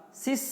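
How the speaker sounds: "Sis" said high, teacher modeling; sounding out a syllable. Shown as a demonstration. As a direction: clear "Sis" with a sharp final s. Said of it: "Dibaca apa?". (suku kata "Sis" dengan nada tinggi)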